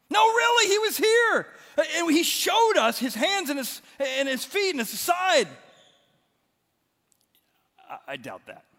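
very high, loud, fast breathless news; excited disciples. Acted. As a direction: blurt it out, bursting to tell. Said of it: "they're like".

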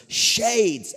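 high, loud shout down the hall; friend yelling. Retold or imitated. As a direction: fling the name across the house. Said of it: "he yells really loud".

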